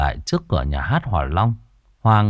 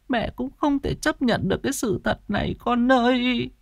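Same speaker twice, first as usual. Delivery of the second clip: high, tearful mother's lament; grieving widow, pitch climbing as she breaks down; sobbing grief; 9 semitones higher than usual. A narrator's reading. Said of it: "Bà Hỏa sụt sùi".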